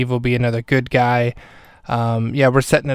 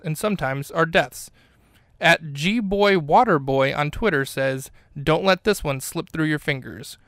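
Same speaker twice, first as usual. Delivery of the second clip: measured, softer podcast sponsor read; mock ad read; 2 semitones higher, 5 dB quieter than usual. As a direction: read the silly book ad in earnest, lilting announcer style.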